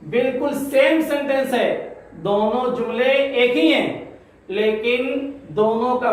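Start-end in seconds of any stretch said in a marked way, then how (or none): none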